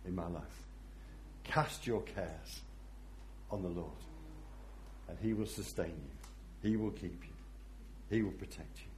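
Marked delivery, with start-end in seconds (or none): 1.4-8.9 soft reassurance. Real, not acted. Pastor softly reassuring the congregation. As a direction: comfort them quietly.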